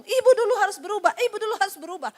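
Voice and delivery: menirukan nasihat dengan nada tinggi dan keras; menirukan pendeta. Retold or imitated. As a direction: tiru suara ibu pendeta menasihati. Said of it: "ibu ngomong". (jemaat menirukan nasihat pendeta sambil tertawa)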